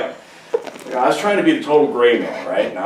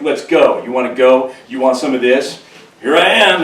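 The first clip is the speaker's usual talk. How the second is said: louder, higher schoolyard challenge; goading bully; 4 semitones higher, 7 dB louder than usual. Retold or imitated. Goliath dares the soldiers, loud mocking challenge. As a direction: push them to step up.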